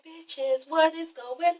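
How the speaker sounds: very soft, confused aside; baffled undertone. Acted. Usher questioning the spaced-out dancers, baffled undertone. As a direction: mutter it to the others, acted.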